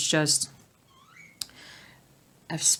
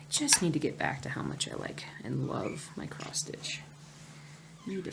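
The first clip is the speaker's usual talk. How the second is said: whisper; 2 semitones lower, 9 dB quieter than usual. Real, not acted.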